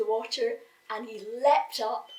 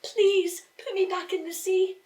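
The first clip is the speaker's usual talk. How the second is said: higher, louder pleading little fish; crying fish; 9 semitones higher, 6 dB louder than usual. A narrator's reading. Fish begging the boy, high pleading cry. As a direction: cry out to be put back. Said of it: "the fish cried".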